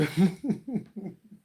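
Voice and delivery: high, bubbling chuckle; amused laugh. Real, not acted. Streamer laughing at a viewer's joke, amused chuckle. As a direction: laugh in a quick high run.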